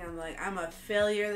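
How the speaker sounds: normal talking voice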